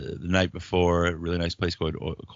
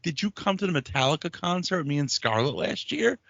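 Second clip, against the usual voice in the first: higher put-on voice; retold jab in a raised voice; mock challenge; 9 semitones higher than usual. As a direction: needle her with it. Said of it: "I'm like".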